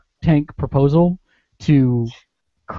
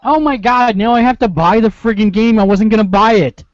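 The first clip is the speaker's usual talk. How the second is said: excited; 7 semitones higher, 5 dB louder than usual. Real, not acted. Thrilled by Nine Inch Nails news.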